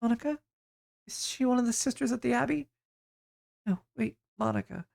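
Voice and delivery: put-on high, small, distant girl's voice; dazed waif girl.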